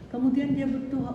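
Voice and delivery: ordinary delivery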